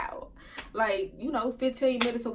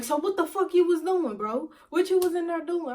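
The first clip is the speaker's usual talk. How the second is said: higher-pitched, indignant demand; accusing interrogation; 6 semitones higher, 3 dB louder than usual. Retold or imitated. Boyfriend questioning her outside the club, accusing. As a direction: press for an answer, voice raised in pitch.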